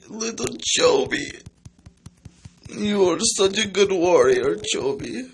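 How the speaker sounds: put-on high, tender baby voice; doting baby talk. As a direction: coo at a dying pet. Doting on dying game character.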